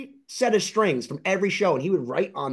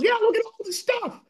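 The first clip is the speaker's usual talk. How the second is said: eager quote, pitched much higher; imitating Jimmy. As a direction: show off the stuff in his voice, up high. Quoting Jimmy showing his collection.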